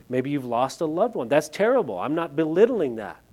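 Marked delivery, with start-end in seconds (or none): none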